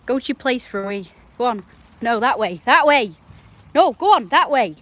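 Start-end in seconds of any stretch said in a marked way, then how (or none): 1.4-2.6 raised, firm direction; real firm call to the dog; directing the dog. Real, not acted. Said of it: "I'm yelling at the dog". 2.7-4.8 much louder, higher shout of that way; real shout at the dog; yelling at the dog. Real, not acted. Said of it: "I'm yelling at the dog".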